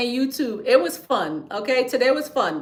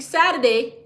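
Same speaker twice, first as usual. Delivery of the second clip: ordinary delivery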